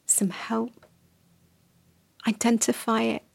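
gently walk the listener through naming their needs; guided reflection. Acted. Guides a quiet self-reflection.

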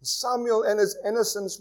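ordinary talk

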